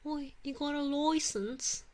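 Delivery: high, slow mock London accent. Streamer practising for UK DM, mock London accent. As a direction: stretch it out in exaggerated London style. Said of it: "Proper London accent or posh?".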